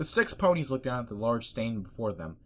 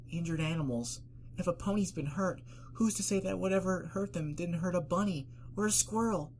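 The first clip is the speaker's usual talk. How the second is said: timid worried pony; 9 semitones higher than usual. Acted. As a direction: fret gently about small animals. Fluttershy worried.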